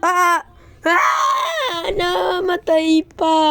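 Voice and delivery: high, loud, slow wail of defeat; anguished shout. Real, not acted. Gamer dying on an obstacle, wailing loudly. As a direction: wail it as your character dies.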